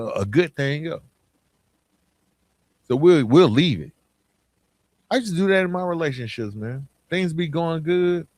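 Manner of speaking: usual speaking voice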